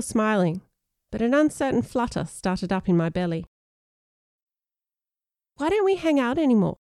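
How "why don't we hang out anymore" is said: put-on higher-pitched boy's earnest question; Sean's normal voice. Sean asking an old friend, normal boyish voice. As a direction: ask it straight, pitched up as a boy. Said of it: "Sean asked in his normal voice".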